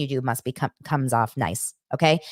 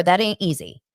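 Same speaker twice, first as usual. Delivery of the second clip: ordinary talk, nothing marked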